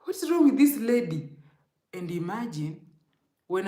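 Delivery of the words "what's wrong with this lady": incredulous. Retold or imitated. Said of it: "And I was like".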